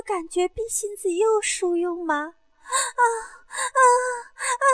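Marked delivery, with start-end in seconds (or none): 2.2-4.7 high, evenly spaced moans; three high moans with breaths between; acted moans. Acted moans in erotic scene.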